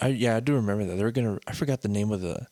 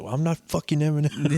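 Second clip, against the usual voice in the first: higher-pitched imitated protest; mock yell; 6 semitones higher than usual. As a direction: imitate a rapper's mild, exasperated yell. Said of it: "supposedly yelled at [name]".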